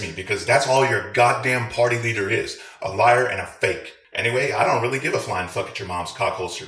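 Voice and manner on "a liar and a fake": usual speaking voice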